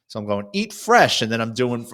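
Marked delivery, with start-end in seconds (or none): 0.5-1.2 high, loud teasing jingle call; high-pitched ad slogan impression; mock commercial slogan. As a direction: belt out the slogan to tease him. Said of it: "doing the Subway commercials".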